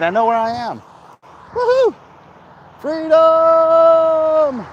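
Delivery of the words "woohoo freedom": high, loud joyful yell; excited whoop. Real, not acted. Dashing across a busy street, whooping loud. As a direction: cheer like you just escaped. Celebrating after crossing the street.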